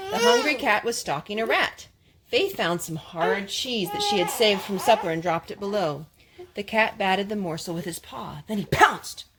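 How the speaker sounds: usual speaking voice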